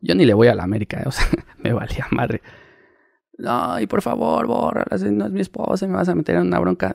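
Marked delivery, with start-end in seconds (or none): 3.4-6.9 high, soft begging voice of a caught footballer; pleading footballer. Retold or imitated. Footballer imitated begging the photographer to erase the photos, high and soft. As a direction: beg him quietly and high to delete them.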